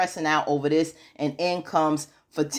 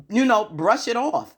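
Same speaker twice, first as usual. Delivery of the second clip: ordinary delivery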